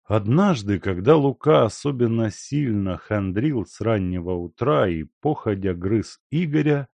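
usual speaking voice

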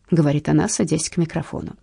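usual speaking voice

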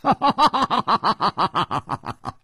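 high falling laugh; a long high laugh that falls away; sly character laugh. A narrator's reading. Nangong Ling laughs after speaking.

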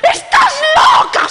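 very high, loud, slow shriek; hysterical shout. Acted. Friends yell at the hostess, very high hysterical shout. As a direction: shriek it in disbelief. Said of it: "Se pusieron histéricas".

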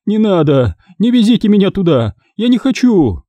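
high, frightened woman's plea; frightened pleading. Acted. Woman begs not to be taken.

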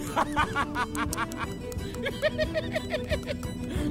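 long laugh climbing high; staged cackle. Acted. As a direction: peals of performed laughter rising in pitch. Staged laughter after slogan.

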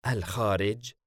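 mildly surprised query; puzzled question. A narrator's reading. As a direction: question it with a touch of wonder. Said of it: "دنهش باستغراب". (استغراب خفيف)

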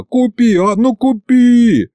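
put-on high drawn-out childish pleading; boy nags grandpa to buy them; whiny begging. A narrator's reading. High slow wheedling.

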